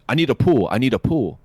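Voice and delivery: a hint of Korean accent, clipped English; thick Korean accent. Mimicking the Korean technician in a thick Korean accent. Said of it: "with this very thick Korean accent".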